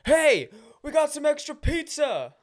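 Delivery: high, drawn-out calling out; yelling down the hall. Acted. College guy offering food in the hall, yelling. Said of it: "and yells".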